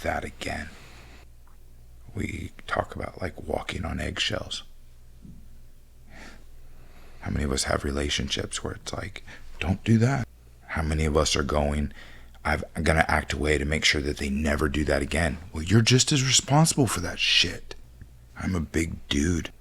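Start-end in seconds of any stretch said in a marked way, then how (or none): 2.1-15.4 much softer, lower voice; quiet lowered confiding tone; soft reflective. Real, not acted. 15.5-17.7 whispered aside. Real, not acted.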